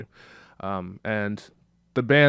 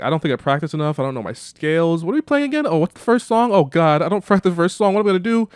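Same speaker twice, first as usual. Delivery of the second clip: much higher, louder rising panic; panicked babble; 7 semitones higher, 7 dB louder than usual. Retold or imitated. Nina panicking on stage before the first song, high and frantic. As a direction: spiral into frantic nerves.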